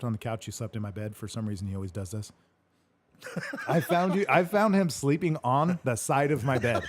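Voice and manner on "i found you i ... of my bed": laughing through the words, slowed. Real, not acted. Laughing while telling how brother slept on bed edge. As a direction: giggle it out in disbelief.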